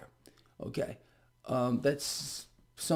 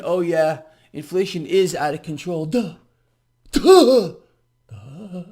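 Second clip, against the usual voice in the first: mocking impression; 9 dB louder than usual. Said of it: "She's saying".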